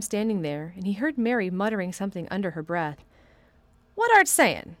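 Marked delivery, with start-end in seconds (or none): 3.9-4.8 put-on sharp, high and loud old gardener; testy old gardener, high and loud. A narrator's reading. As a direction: snap the question. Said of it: "he asked rather testily".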